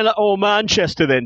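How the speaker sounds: put-on regional English accent; mock British accent. Co-host answering about England, hammed-up British accent. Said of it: "depends on where in England".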